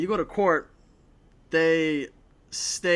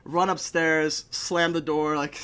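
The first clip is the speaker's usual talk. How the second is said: normal talking voice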